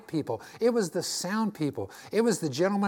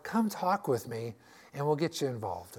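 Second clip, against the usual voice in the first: normal talking voice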